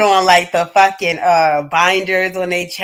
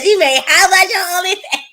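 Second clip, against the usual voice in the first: much higher, stunned mock husband; hammed-up astonished husband; shocked husband; 9 semitones higher than usual. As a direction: squeal the question in disbelief. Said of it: "He said".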